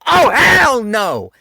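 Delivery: loud, high, drawn-out hell no; outraged refusal. Retold or imitated. Vlogger recoiling from the story, loud outraged yell. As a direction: shout it like you just saw something vile. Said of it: "I knew".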